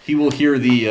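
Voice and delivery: usual speaking voice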